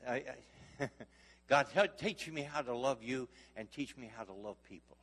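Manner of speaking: normal talking voice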